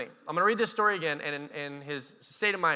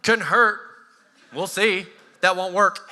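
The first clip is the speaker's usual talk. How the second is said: louder, punchy back-and-forth; mock argument; 2 semitones higher, 8 dB louder, slower than usual. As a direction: argue it out playfully. Acting tire-changing argument.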